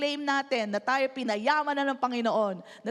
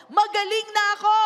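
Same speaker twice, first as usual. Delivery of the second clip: "Magaling na ako" called out higher and louder, rising on "ako"; loud declaration; 5 semitones higher, 6 dB louder than usual. Shown as a demonstration. Pastor calling the claim out to the congregation, loud. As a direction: proclaim "Magaling na ako" boldly. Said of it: "One more time".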